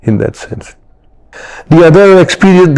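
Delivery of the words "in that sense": softer, almost breathed repeat; whispered afterthought. Real, not acted. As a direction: let the phrase fade into a whisper. Repeated phrase, then whispered.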